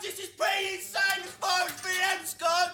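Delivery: excited shout. Real, not acted. Shouting a video message to fans.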